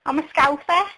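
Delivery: the real Scouse voice, unforced; Scouse accent. Real, not acted. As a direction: own natural Liverpool Scouse accent. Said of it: "thick Scouse accent".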